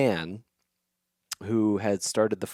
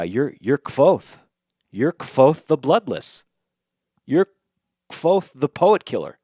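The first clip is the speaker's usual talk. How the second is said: much higher and louder, naming the legend in awe; excited insistence; 7 semitones higher, 10 dB louder than usual. Retold or imitated. Traveler insisting the innkeeper is Kvothe, awed and loud. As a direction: proclaim his famous titles with growing thrill. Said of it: "And he says".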